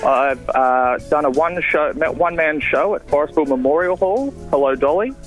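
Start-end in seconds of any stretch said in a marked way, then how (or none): none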